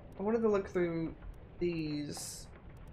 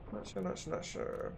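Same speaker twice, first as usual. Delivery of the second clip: muttered to self; 6 dB quieter than usual. Real, not acted. Player giving up on a clue, fading to a whisper. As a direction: fade down to a near whisper. Trailing repeat to herself.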